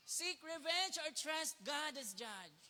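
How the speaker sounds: slow, weighty reading of the question; slow emphatic reading. Shown as a demonstration. Preacher putting the choice to the congregation, slow. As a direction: draw the question out slowly.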